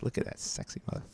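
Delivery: low breathy whisper. Retold or imitated. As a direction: whisper it like a secret thought. Admiring partner, quoted inner thought.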